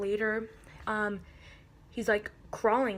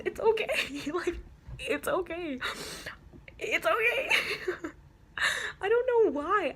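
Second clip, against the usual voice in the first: high-pitched, giggling reassurance; laughing; 9 semitones higher than usual. Real, not acted. Owner brushing off condolences over dead hamster, laughing. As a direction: squeak it out through real laughter. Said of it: "I'm laughing".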